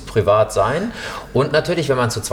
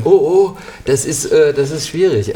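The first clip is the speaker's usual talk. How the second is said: worried; 3 semitones higher, 4 dB louder than usual. Retold or imitated. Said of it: "du hast schon im Vorhinein gesagt".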